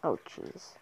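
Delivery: ordinary talk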